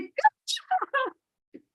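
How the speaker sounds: very high squeaky mock praise; mock instructor praise. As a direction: tease the instructor with a piping good job. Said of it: "the surf instructor's like".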